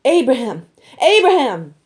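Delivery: two loud, high cries of the name; urgent call. A narrator's reading. Angel stops Abraham's knife, calling loud and high. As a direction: stop him mid-stroke with a shout from above. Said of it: "called out to him from heaven".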